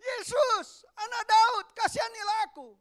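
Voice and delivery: very high, loud desperate shout. Acted. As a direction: scream for mercy over everyone. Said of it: "tambah keras dia berteriak".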